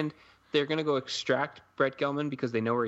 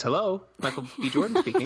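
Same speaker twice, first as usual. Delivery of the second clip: lifted, louder mock phone greeting; answering a phone call; 6 semitones higher, 4 dB louder than usual. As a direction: play a hammed-up phone pickup. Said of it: "So he says".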